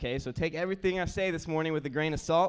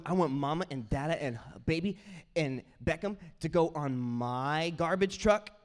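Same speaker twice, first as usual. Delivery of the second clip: imitating a small child's earnest wish; toddler voice; 2 semitones higher than usual. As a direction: piece it together like a toddler. Voicing toddler's rambling wish.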